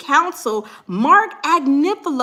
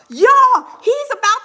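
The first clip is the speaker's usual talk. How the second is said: high loud call to the audience; alarmed shout; 9 semitones higher, 7 dB louder, slower than usual. Real, not acted. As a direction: blurt it out in shock.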